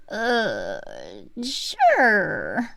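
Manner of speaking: reluctant "sure" forced through teeth; through gritted teeth. Acted. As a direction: squeeze it out through clenched teeth. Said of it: "Chelsea says through gritted teeth".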